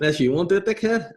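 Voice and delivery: half-playful exasperated nurse jab; mock irritated midwife. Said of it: "she goes".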